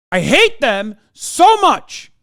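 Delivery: loud, slow, seething declaration; hateful outburst. Real, not acted. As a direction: drag it out loud with real disgust. End of rant on Canadians.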